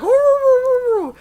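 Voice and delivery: high, loud wooing dog howl; dog howl imitation. Dog joining the family's noisy laughing, mock howling. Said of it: "And then the dog's like".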